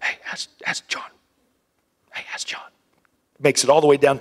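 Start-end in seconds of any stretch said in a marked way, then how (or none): 0.0-2.7 breathy passed-along whisper. Retold or imitated. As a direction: whisper down the line. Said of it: "they whisper".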